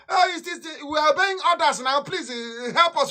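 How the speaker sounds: hammed-up pleading; mock pleading soldier. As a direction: mimic a soldier whining for mercy. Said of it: "don't tell me tomorrow".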